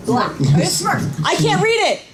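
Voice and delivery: ordinary talk, nothing marked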